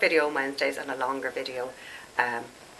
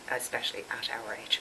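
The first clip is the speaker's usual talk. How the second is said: whisper; 4 semitones lower, 7 dB quieter than usual. Real, not acted. Confiding aside about age.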